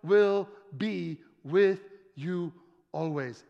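slow, word-by-word assurance; measured promise. Acted. Risen Jesus promising his disciples, slow and deliberate. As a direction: space out every word solemnly. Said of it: "then he tells his disciples".

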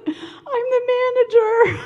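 high, loud, tearful reply; tearful manager. Retold or imitated. Answering the police at the door, high and tearful. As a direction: blurt it out near tears. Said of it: "I said".

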